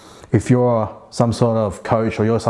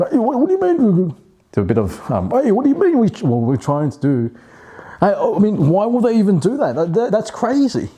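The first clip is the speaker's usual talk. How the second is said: shocked disbelief; 11 semitones higher than usual. Shown as a demonstration. Acting out a conversation, shocked and high. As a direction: sound stunned by what you heard. Acted shocked reaction in demo.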